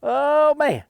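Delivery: loud, high groan of surprise; excited exclaim. Real, not acted. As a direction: react big to the shot. Reacting to his own shot.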